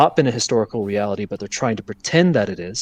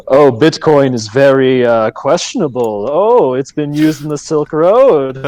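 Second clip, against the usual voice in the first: slow, haughty official tone; pompous bureaucrat voice; 3 semitones higher, 5 dB louder than usual. Retold or imitated. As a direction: sneer it like a self-important bureaucrat. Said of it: "it's my pompous bureaucrat voice".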